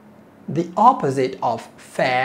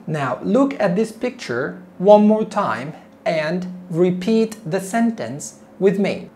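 ordinary talk

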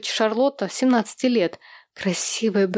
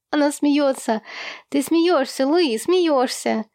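put-on high, excited child voice; delighted little boy; 6 semitones higher than usual. A narrator's reading. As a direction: crow happily, stretching the last word. Little Sam cheering his sister's laughter.

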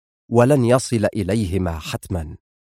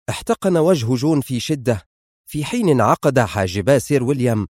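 usual speaking voice

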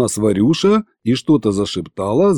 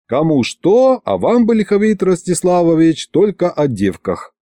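higher-pitched, mildly exasperated reproach; sighing; 7 semitones higher than usual. A narrator's reading. Husband chiding girl-obsessed old sorcerer, sighing. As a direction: chide him with a touch of a sigh. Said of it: "вздохнул я".

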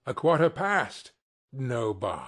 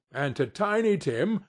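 usual speaking voice

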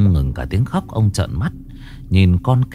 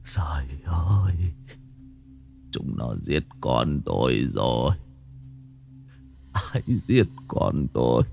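whispered, much lower and softer; tearful whisper; 8 semitones lower, 9 dB quieter than usual. A narrator's reading. Grieving father whispers to himself about his murdered daughter. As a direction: breathe the grief out barely aloud. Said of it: "giọng nói nho nhỏ của ông".

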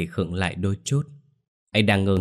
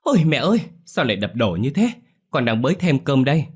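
startled daughter-in-law, pitch jumping up; flustered; 7 semitones higher than usual. Acted. Vân calling up to the angry grandmother, flustered. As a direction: hurry to calm her, alarmed. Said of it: "có phần hoảng hốt".

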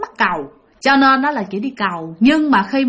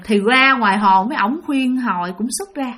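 ordinary delivery

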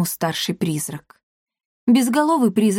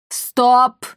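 louder, higher ghostly wail; sepulchral howl; 5 semitones higher, 5 dB louder than usual. A narrator's reading. General halting the squabble, sepulchral howl. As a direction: howl it out as one long command. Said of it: "взвыл генерал Бальдузер за могильным голосом".

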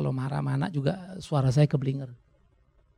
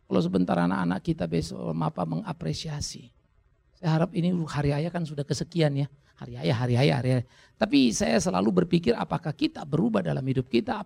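ordinary delivery

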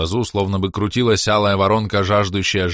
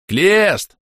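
loud, high-pitched call of a name; shouting; 10 semitones higher, 6 dB louder than usual. A narrator's reading. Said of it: "крикнул я". (громко кричит, зовёт)

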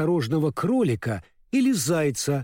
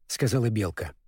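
plain reading voice

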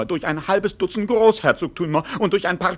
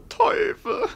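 high, startled curse; surprised curse. Acted. Servant startled to find another border. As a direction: spit it out in surprise.

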